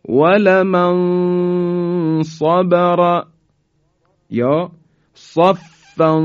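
slow chanted ولمن صبر and صفا; Quran recitation. Shown as a demonstration. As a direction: recite slowly with heavy ص. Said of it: "به این مثال توجه بفرمایید".

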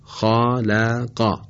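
خالقا slow, heavy first vowel, light last vowel; خالقا said slowly in Quranic style; recitation demo. Shown as a demonstration. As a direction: draw out خالقا carefully.

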